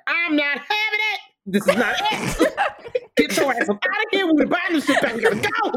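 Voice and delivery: high, laughing put-on voice of a shook old man; Clifton Powell impression. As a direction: squeal it out like a scared man fleeing. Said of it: "And Clifton Powell's like".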